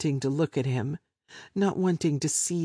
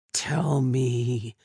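growled. Acted. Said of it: "he growled the words".

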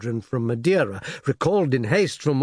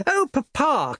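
put-on high, eager girl's voice calling Papa; a daughter spotting the ships, breathless with excitement; excited young girl; 12 semitones higher, slower than usual. A narrator's reading. Daughter calls to her father in the crowd, high and eager. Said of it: "cried the elder girl".

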